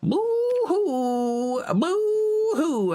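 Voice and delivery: put-on high, slow mopey sad-boy voice; sad crybaby lad. Player hamming up a miserable sad lad while foraging. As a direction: whine it like a self-pitying sad lad.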